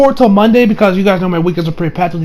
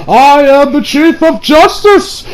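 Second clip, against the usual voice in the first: higher, slow and grand superhero shout; heroic hero proclamation; 8 semitones higher, 4 dB louder, much slower than usual. Retold or imitated. Gohan playing hero on set, slow grand proclamation. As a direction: proclaim it like a costumed hero posing.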